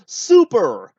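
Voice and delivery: loud, high exaggerated "Super!"; Bobby Heenan. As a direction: shout it like a gleeful commentator. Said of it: "Bobby Heenan just replies".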